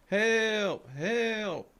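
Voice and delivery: high-pitched imitated call; retold cry for help. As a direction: mimic a distant voice crying out. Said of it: "I start hearing".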